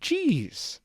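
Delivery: high startled yelp; startled shout. Acted. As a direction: jump back with a startled cry. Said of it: "Discord shouted".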